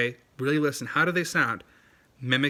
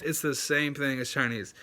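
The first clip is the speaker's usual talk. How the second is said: normal talking voice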